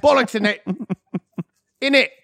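high-pitched cartoon English accent; hammed-up English tag questions. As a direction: tack it on in a mock English accent. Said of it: "I'm giving her an English accent".

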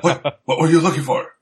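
high, loud hammed-up movie demand; bad kung fu dub. Co-host playing the fighter questioning Danny, kung fu movie parody. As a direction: throw it out like a badly dubbed villain. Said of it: "worst edited kung fu movie voice".

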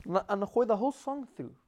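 usual speaking voice

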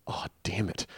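hushed whisper of dread. Retold or imitated. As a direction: breathe it out like you know trouble's coming.

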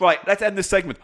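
ordinary talk, nothing marked

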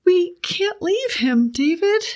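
high, slow pleading woman's voice; high, drawn-out and distressed. A narrator's reading. Betty pleads not to leave Eric.

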